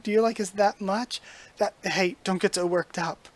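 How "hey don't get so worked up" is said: ordinary delivery, nothing marked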